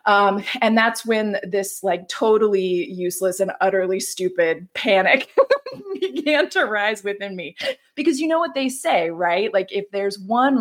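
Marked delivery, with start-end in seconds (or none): 5.3-6.0 short high giggle; high laugh. Real, not acted. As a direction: giggle at your own silliness. Laughs at own panic.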